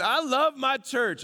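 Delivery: high, sugary churchgoer gushing; mock gushing churchgoer. Pastor mimicking a churchgoer, sweet and gushing. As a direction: oversell the love for church.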